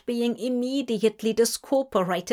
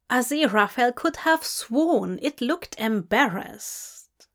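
ordinary delivery

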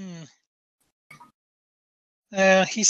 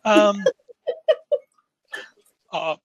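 ordinary talk

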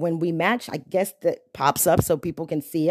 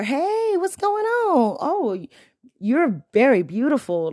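higher, sweet greeting with a compliment; friendly compliment demo; 5 semitones higher, slower than usual. Shown as a demonstration. Showing men a respectful opener, sweet and high. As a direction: greet her kindly, then compliment. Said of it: "a compliment".

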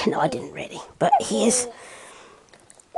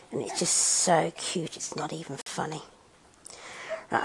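ordinary delivery

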